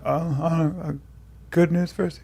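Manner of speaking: quiet, halting reply; hesitant student. Acted. Student put on the spot by the coach, hesitant and quiet. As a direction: answer softly with stumbling uhs. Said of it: "And the person".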